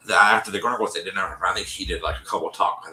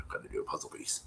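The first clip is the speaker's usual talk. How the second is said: "gotta do a puzzle piece" whispered, much softer, lower; whispered aside; 9 semitones lower, 14 dB quieter, faster than usual. Real, not acted. Speaker reminds herself mid-story, whispering. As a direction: breathe "gotta do a puzzle piece" under your breath.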